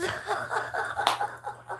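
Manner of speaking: high breathy wheeze laugh; breathless laugh; airy giggle. Real, not acted. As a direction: let out a silent squeaky giggle. Laughing at troll.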